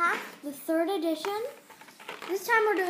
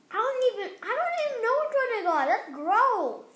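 disgusted outburst; 6 semitones higher, 4 dB louder than usual. Real, not acted. Disgusted by the bean.

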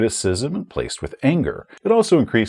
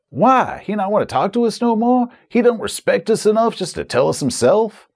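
offended gamer; 9 semitones higher than usual. Acted. Offended player in an acted skit.

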